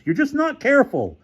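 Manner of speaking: very high-pitched furious repeat; exasperated rage in a high register; raging. Acted. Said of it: "Larry raged".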